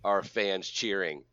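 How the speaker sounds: big host voice, lifted on key words; show-opening announcer. Acted. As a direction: hype up the episode like a host. Hosts the show's opening.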